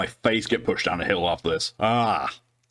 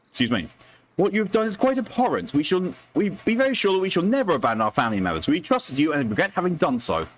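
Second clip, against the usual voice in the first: much higher, formal indignant reading; indignant letter voice; 10 semitones higher than usual. Acted. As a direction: read the complaint like a wronged aristocrat. Reads complaint letter in character.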